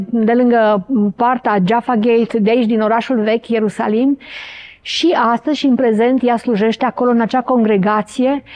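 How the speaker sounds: usual speaking voice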